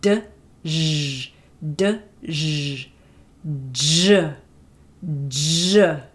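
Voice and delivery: "d, zh" split then fused into "j". Shown as a demonstration. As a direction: build "j" from its parts. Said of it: "releasing it into zh".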